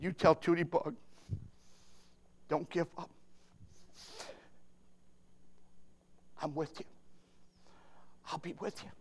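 low voice fading very soft; tender reassurance. Acted. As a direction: give God's message gently, almost under the breath. Voices God comforting Marty.

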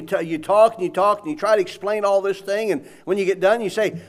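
ordinary talk

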